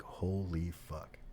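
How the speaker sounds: slow, hushed disbelief; quietly stunned, words drawn out; retold stunned realization. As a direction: let it sink in slowly and softly. Stunned on spotting old instructor.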